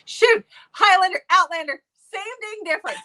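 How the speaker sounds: high, loud, flustered burst; flustered laughing excuse. Real, not acted. Reviewer caught mixing up titles, flustered high excitement. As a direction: brush off the slip with a yelp.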